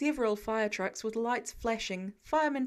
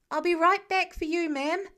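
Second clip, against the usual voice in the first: higher, loud call up to her; called promise; 6 semitones higher, 6 dB louder than usual. A narrator's reading. Peter calling up to the trapped woman, loud promise. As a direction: call a promise across the flames. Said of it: "Peter promises".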